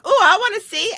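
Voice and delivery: put-on high, loud eager kid voice; mimicking eager kids. Mother mimics the kids begging to see, high excited kid voice. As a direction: squeal it like a curious kid.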